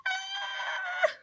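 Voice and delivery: very high, thin squeal of joy; delighted squeal. Real, not acted. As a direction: let out a tiny happy shriek. Overjoyed at the cow toy.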